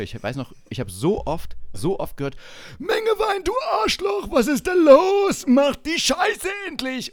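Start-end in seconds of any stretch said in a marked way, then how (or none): 2.8-7.1 high, loud barking shout; mock officer yelling. Superior yelling at the private, mock furious shout. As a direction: bellow at the lazy private like a drill sergeant.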